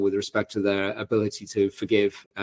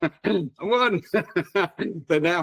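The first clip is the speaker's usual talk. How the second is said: laughing; 7 semitones higher, slower than usual. Real, not acted. Laughs telling of sneaky win.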